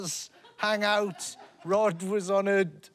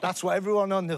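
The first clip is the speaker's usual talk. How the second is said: ordinary talk, nothing marked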